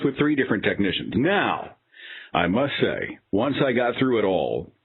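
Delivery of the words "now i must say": ordinary delivery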